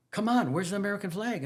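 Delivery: insistent principal. Retold or imitated. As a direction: push him for the flag again. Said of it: "he says".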